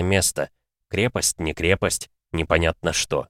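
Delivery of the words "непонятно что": ordinary delivery, nothing marked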